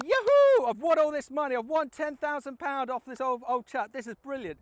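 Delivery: loud, high whoop of triumph; gleeful whoop. Retold or imitated. Taxman whooping with joy over winning ten thousand pounds.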